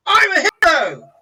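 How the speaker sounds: put-on high, loud child's cheer; proud little boy. Little boy brags after stabbing troll, high loud cheer. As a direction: shout it like a proud small kid. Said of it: "He goes".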